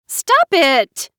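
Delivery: loud high angry outburst; storming. A narrator's reading. Loud high angry outburst. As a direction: Mara Joy storming at her sister's claim. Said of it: "Mara Joy stormed".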